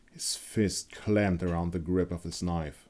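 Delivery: ordinary delivery, nothing marked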